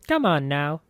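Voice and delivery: a hint of an old woman coaxing; lightly voiced, coaxing the corpse; Marta coaxing corpse. A narrator's reading. Old woman coaxing a corpse, lightly voiced hag. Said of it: "she clicked her teeth".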